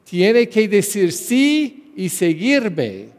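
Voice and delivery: preacher voicing Jesus's stern call; firm command. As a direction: lay down the law, no excuses allowed. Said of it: "él estaba diciendo".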